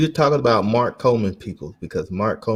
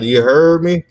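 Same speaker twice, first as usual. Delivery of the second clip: mock Southern phrase; 5 semitones higher, 6 dB louder than usual. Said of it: "he tried to say".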